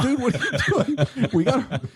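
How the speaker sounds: high-pitched retold reaction through laughter; laughing disbelief. Telling hosts how he reacted to partner's panic, laughing high. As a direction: squeal the quote while laughing.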